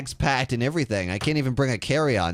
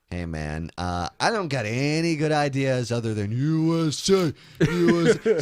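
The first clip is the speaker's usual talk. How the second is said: mock meathead chanting U S A; dumb jock voice; 2 semitones higher than usual. Mocking a debater who only chants U S A. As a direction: play a clueless bro with no ideas.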